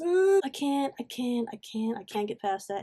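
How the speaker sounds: ordinary delivery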